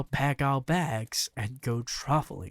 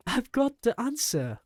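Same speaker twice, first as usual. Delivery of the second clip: put-on high, loud boyish shout of discovery; excited shout; 12 semitones higher, 4 dB louder than usual. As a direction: burst out with the idea, yelling. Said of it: "I shouted".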